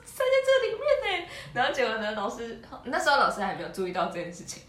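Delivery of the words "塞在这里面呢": much higher, smug show-off voice; boy proudly showing off his head in the railing, smug; proud boast. Retold or imitated. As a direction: brag like a kid pleased with his trick. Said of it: "很骄傲的跟我们说".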